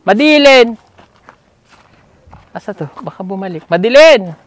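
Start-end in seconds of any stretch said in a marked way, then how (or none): 0.0-0.8 loud higher call to someone far. Real, not acted. Calling a student from afar. 3.7-4.3 loud higher call to someone far. Real, not acted. Calling again.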